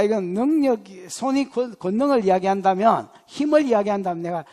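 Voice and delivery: ordinary delivery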